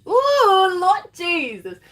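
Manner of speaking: high, slow swooning Ooh, Lord Jesus; swooning exclamation. Real, not acted. Swooning over Gordon on camera, high and slow. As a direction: gush over him like he just walked in.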